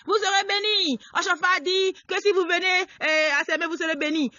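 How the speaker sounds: mock preacher's loud, high proclamation; prosperity preacher. Preacher mimicking empty promises of blessing to the congregation, high and loud. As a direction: call out blessings like a crowd-rousing preacher. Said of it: "Tout ce qu'on nous donne".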